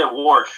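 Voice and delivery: "warsh" said with an r before the sh. Retold or imitated. Man imitating how Civil War-era people said "warsh". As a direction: stretch "warsh" with a clear r. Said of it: "I've heard people say".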